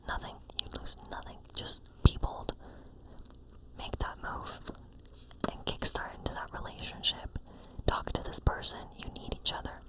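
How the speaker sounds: ordinary delivery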